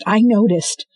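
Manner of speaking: loud, tart retort; sarcastic reply mid-chase; snappy sarcasm. Acted. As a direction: snap back dryly. Sarcastic reply under stress.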